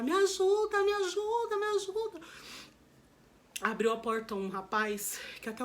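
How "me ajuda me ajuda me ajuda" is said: high, desperate repeated begging; calling for help alone in the room; pleading for help. Retold or imitated. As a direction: cry out for help over and over. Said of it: "eu comecei a falar".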